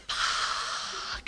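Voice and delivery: long, drawn-out whispered swear; voiceless, stretched-out expletive; whispered curse. Retold or imitated. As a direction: let the curse hiss out in dread. Waters break, she reacts.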